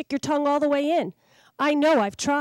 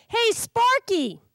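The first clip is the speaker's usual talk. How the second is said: much higher, very slow hail; German shepherd calling to the puppy; neighbor dog calling; 11 semitones higher, 4 dB louder, much slower than usual. Acted. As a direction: call over the fence. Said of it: "barking at me through the fence".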